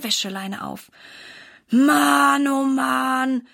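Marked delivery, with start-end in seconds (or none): 1.7-3.5 höher und lauter aufgestöhnt; stöhnend. A narrator's reading. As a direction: genervt aufstöhnen über die Wäsche. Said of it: "stöhnt Silas". (Silas stöhnt beim Wäscheaufhängen, laut genervt)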